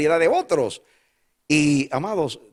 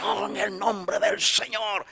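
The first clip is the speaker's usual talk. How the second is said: lightly mock the overly spiritual; mock pious voice; 8 semitones higher, slower than usual. Pastor mocking over-spiritual believers, mock pious tone. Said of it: "a veces somos muy espirituales".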